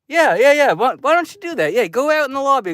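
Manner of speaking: high-pitched, rapid-fire bossing; mock indignant. Moviegoer tempted to send the lady for popcorn, mock indignant. Imagined snapping at apologetic lady.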